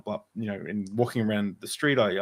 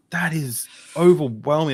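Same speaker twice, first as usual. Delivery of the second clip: higher, louder, drawn-out amazement; overwhelmed; 6 semitones higher, 6 dB louder than usual. Retold or imitated. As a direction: marvel at it emphatically. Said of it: "I was so overwhelmed with".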